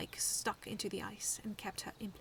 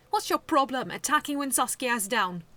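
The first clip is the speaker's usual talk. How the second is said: loud, higher indignant shout; angry yell; 5 semitones higher, 15 dB louder than usual. A narrator's reading. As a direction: confront him hard. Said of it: "Medaby yelled".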